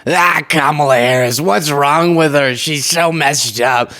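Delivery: slow, sneering Trump impression; mocking Trump voice. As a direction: drag it out with a scornful sneer. Mocking Trump's words about Harris.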